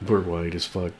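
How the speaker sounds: usual speaking voice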